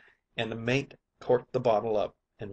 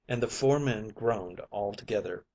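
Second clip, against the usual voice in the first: plain reading voice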